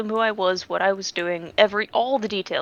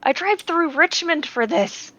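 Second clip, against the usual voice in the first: slow, higher outraged complaint; indignant vent; 4 semitones higher, 3 dB louder than usual. Real, not acted. Telling friends about the pointless drive, slow and outraged. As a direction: drag out the bullshit. Venting about wasted trip.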